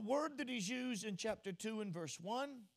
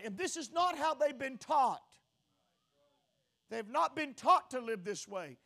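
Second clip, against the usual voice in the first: raised, forceful pulpit voice; impassioned preaching; 5 semitones higher, 5 dB louder than usual. Real, not acted. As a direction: preach it high and loud, driving each claim home.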